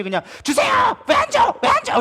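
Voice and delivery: high shouted mock demand at God; hammed-up petulant shout; demanding whine. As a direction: act out a spoiled, impatient prayer, yelling. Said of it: "이러고 있으면".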